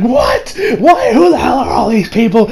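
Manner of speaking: high-pitched incredulous outburst, imitated; mock indignant shock. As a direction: act out being stunned and put out. Voicing Yukiko's shocked reaction.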